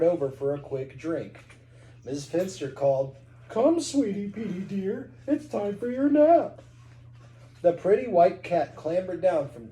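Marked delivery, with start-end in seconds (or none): 3.5-6.6 put-on sweet old lady voice. Lady calls her cat.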